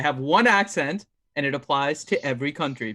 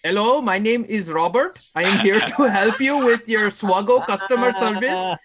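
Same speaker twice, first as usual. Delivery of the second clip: put-on Indian call-centre accent, a little raised; Indian-accented scammer; 2 semitones higher than usual. Friend asked to voice Nev's gift-card scam, Indian accent. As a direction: do an Indian-accented customer service agent. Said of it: "You need to talk with the accent".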